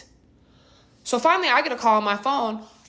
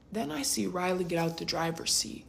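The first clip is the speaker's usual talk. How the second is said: ordinary talk, nothing marked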